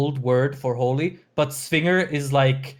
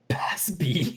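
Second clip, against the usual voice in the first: whispered; 6 dB quieter than usual. Real, not acted. Reading the name in disbelief.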